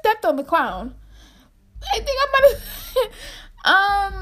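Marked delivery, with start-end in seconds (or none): 1.9-4.2 breaking into laughter, slow and much higher; laughing. Real, not acted. Admitting to viewers she may have stepped on the clown, laughing. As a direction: giggle through the admission.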